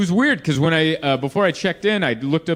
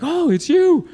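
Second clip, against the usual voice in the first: much higher, bright gushing "oh"; delighted recognition; 11 semitones higher, 3 dB louder than usual. Retold or imitated. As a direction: play the thrilled clerk spotting a celebrity. Hotel clerk recognizes him.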